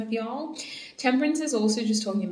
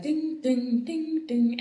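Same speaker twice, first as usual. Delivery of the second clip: mock bell sound, pitched higher; high imitated dings; 4 semitones higher, slower than usual. Shown as a demonstration. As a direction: sing out ding ding ding a little higher, off-beat. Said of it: "instead of ding, ding, ding, ding".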